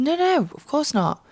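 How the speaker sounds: a mock-innocent no, stretched out; denying. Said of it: "I said".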